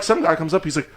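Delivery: ordinary talk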